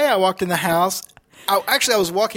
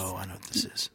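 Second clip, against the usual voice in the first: whisper; 9 semitones lower, 15 dB quieter than usual. Pretend. Mock dread aside.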